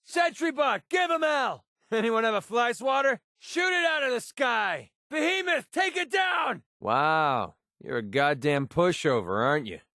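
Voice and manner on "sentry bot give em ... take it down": high, fired-up combat yells; battle shouts. Acted. Fighter calling out each enemy to companion, high yells. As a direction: shout targets over the fight.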